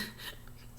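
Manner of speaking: quiet breathy chuckle; brief, soft laugh to yourself; short laugh. Real, not acted. Commentator amused by Johnny's way of talking, quiet chuckle. Laughs after mimicking the clip.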